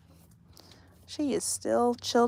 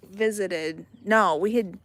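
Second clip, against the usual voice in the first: normal talking voice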